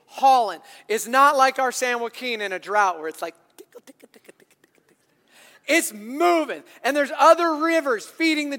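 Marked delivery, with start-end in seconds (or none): 3.6-5.0 whispered trickle. Pretend. Trickle of a drought river.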